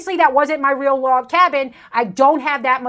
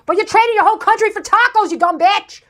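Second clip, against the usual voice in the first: high, fast, louder burst of scolding; exasperated yell, pitched up; angry outburst; 7 semitones higher, 4 dB louder than usual. Real, not acted. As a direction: snap into a heated, rapid insult. Berating the reviewer in the clip.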